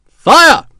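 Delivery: very high, loud command; shouted order. A narrator's reading. Officer ordering the volley on the garrison, shout. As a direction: throw the order out hard. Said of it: "the officer shouted".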